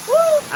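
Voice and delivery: piercingly high, loud "woo"; cold-shower whoop. Real, not acted. Hiker taking a waterfall shower, whooping high and loud. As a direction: let out a sharp whoop under cold water.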